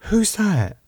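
high, startled question; timid alarm. Acted. Woman seeing Damo on the floor, timid alarm. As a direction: ask in nervous shock.

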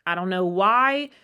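ordinary talk

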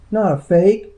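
high, loud indignant denial; stung, high-pitched indignation; hot retort. A narrator's reading. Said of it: "she said hotly".

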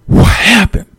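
breathless, high, dying to know; whispered, excited what happened; eager gossip gasp. Retold or imitated. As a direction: gasp it out like you can't wait for the tea. Caller hungry for gossip.

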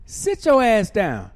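loud, slow order to sit; kicking the chair and ordering her to sit; loud command. Retold or imitated. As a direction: bark it down at her.

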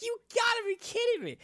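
very high, fast furious cry; shrieking disbelief as the prize falls; mad disbelief. Retold or imitated. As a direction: throw it up in outrage at the last-second drop. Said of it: "Like, I was getting so mad".

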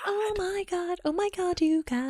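tiny high hushed squeal; mimed silent squeal. Retold or imitated. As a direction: pretend to freak out very softly. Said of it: "I was silently mouthing".

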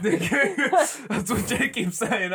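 real laugh through the words; slow, laughing high-pitched agreement. Real, not acted. Friend laughs at the remark.

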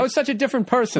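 high-pitched mimicry; mocking imitation. Co-host teasing the host about changing, high mock imitation. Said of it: "He's doing this whole thing".

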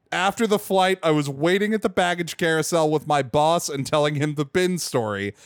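ordinary delivery

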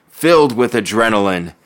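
ordinary delivery

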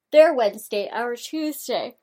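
words stretched through a yawn. Real, not acted. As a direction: talk while yawning. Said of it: "I just yawned in your ear".

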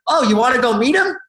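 put-on high, loud drunken call across the party; mock drunk friend shouting; drunk yell. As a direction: yell out his name, tipsy and thrilled. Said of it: "And then she yells".

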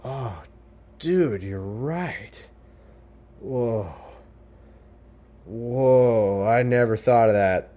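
slow, drawn-out dude having a revelation; hammed-up stoner-like wonder; mock awestruck dudes. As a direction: play someone whose mind is slowly blown. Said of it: "And they're like".

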